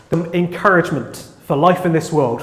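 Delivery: ordinary talk, nothing marked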